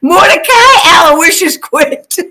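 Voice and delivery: grand shouted name. Acted. Fan proclaiming a joke name for Quint, slow and shouted. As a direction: declaim it like a herald crowning a king.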